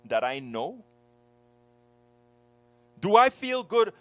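rising, pleading indignation; hurt demanding questions; indignant retelling. Retold or imitated. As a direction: builds to a loud, high outburst. Retold confrontation, rising indignation.

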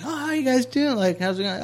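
a hammed-up, high friendly greeting; imitating Kelly. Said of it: "Kelly's like".

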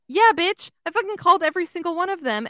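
high, loud, fast mock rant at the receptionist; retold angry outburst. Telling off the vet receptionist, loud fast retold rage. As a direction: fire it back fast and loud, half-acted fury. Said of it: "I was like".

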